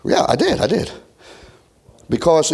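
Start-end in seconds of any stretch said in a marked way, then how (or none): none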